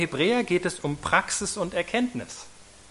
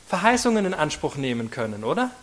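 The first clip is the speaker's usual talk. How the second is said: usual speaking voice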